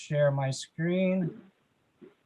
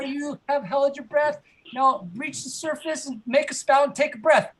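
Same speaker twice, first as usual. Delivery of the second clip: put-on excited kids' game leader; 7 semitones higher than usual. Leads kids in whale game.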